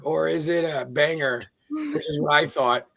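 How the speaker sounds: usual speaking voice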